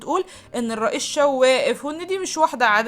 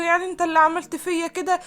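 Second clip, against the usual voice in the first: higher-pitched plaintive question; hurt protest; 8 semitones higher than usual. A narrator's reading. Patient to the doctor who wronged her, hurt protest.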